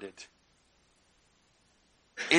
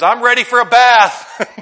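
higher, louder, slow, laughing through it; Peter's eager offer, laughing; 7 semitones higher, 9 dB louder, slower than usual. Retold or imitated. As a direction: Peter ready to jump in the tub. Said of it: "And then Peter says".

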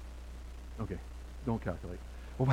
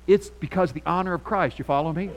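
ordinary talk, nothing marked

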